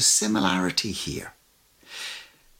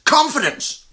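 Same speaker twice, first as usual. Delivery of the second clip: high, louder "Confidence" declared; bold divine word; 12 semitones higher, 4 dB louder than usual. Retold or imitated. Jesus answering terrified disciples, high bold "Confidence". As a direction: announce "Confidence" like a divine command. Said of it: "and he goes".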